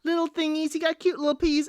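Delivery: lightly mocking cutesy gush. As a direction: coo over the dog like it's adorable. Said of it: "She's like".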